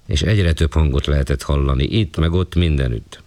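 ordinary delivery

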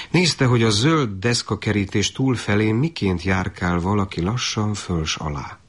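usual speaking voice

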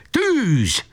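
high sharp order to fire; shouted command. A narrator's reading. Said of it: "elkiáltassa magát".

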